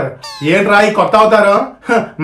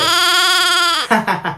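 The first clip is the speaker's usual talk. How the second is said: evil laugh; 8 semitones higher, 5 dB quieter than usual. Acted. After threatening to fry the son, a high villainous cackle.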